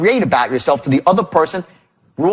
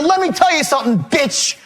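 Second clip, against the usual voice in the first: high, threatening retold tirade; pitched-up irate warning; really angry; 9 semitones higher than usual. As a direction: lay into the caller, acting out rage. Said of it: "I'm really angry".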